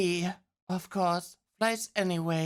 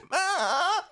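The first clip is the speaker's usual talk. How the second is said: laughing fit; 11 semitones higher than usual. Real, not acted. Streamer laughs at Barry talking like a dream. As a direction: lose it laughing.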